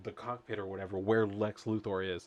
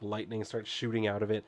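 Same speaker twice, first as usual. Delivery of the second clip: ordinary talk, nothing marked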